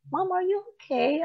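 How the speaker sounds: put-on a gentle kid's worried ask; innocent child. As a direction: kids looking up at mom, asking if she's okay. Kids' innocent faces looking at her.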